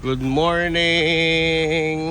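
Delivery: sing-song slow good morning; drawn-out greeting. Acted. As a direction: stretch it out very slowly.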